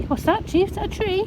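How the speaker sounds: very high cooing pet voice; coaxing the cats. Real, not acted. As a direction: sweet-talk the cats out, high and sing-song. Talking to cat.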